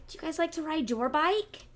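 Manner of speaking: ordinary delivery, nothing marked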